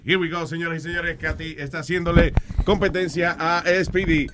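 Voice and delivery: ringside announcer hyping the crowd; lucha libre announcer. Acted. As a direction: call the match like a wrestling announcer. Arm wrestling match hype commentary.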